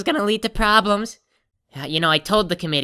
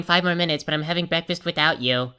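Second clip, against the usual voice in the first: ordinary talk, nothing marked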